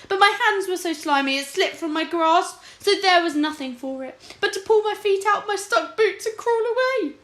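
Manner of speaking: high, loud panicked king recounting his escape; a terrified king gasping out his tale; frantic king. Acted. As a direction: blurt it out high and desperate.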